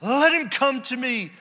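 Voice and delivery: loud, high, drawn-out cry; shouting. Acted. Preacher shouting Jesus' call to the congregation. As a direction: shout the invitation across the hall. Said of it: "I'm shouting".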